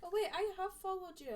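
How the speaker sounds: loud, high excited burst; sudden realisation. Real, not acted. Co-host realises they already follow him, excited blurt. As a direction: blurt it out as it dawns on you.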